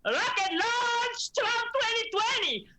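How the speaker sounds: put-on high, slow Venezuelan accent, crowd chant; Venezuelan accent impression. Mother imitating a Venezuelan rally woman's accent for the panel. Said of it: "obsessed with her accent".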